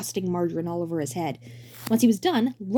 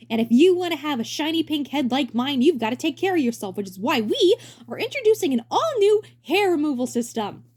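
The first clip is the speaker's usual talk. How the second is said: hammy stage actor; 8 semitones higher, 5 dB louder than usual. Acted. Roy presenting.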